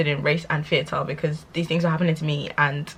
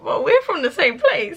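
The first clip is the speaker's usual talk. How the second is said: laughing retort; 8 semitones higher than usual. Retold or imitated. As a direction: laugh it off in disbelief. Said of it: "in my head, I'm like".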